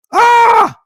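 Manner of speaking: high, loud gasp of joy; delighted cry. Retold or imitated. Aunt finishing her speech, high loud joyful cry. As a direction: burst out in delight.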